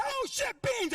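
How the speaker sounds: mock panic over beans; startled cry. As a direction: yelp it like you spotted danger. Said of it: "they said".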